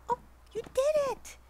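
overjoyed at the little dog; high gushing praise for the pet; delighted praise. Real, not acted. Pet finally makes it.

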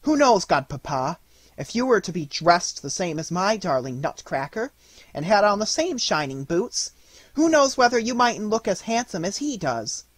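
put-on high, bright child's voice, earnest; a small girl pleading her point sincerely; earnest little girl. Little Marie earnestly telling her godfather, child voice. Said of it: "she said with much earnestness".

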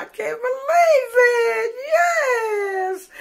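high tearful cry of joy; real happy tears breaking through; overwhelmed, crying out in disbelief; emotional. Real, not acted. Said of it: "I'm just so emotional right now".

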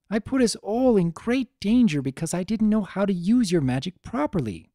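put-on a distressed young heroine blaming herself; upset young mare. Twilight speaks through tears.

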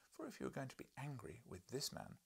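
plain reading voice

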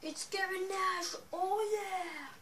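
high, loud, slow entrance call; ring announcer. Pretend. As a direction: introduce the wrestler like an arena announcer. Announcing own wrestler entrance. (annonceur de ring, voix d'entrée)

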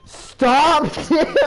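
real laughing plea to a friend; laughing protest. Real, not acted. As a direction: beg Tim to stop through real laughter. Tim spamming invites.

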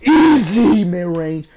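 slow, stretched, loud yell of easy; drawn-out scream. Real, not acted. Gamer howling over his own mid-range shot, slow and loud. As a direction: howl it out long after the shot drops. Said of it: "I'm sorry I'm screaming".

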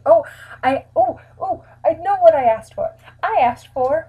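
light up with the memory; excited realization. Real, not acted. Sudden remembering.

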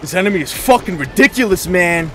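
loud, high furious yell; angry shout. Real, not acted. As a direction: rage at the screen. Raging at enemy.

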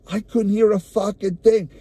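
normal talking voice

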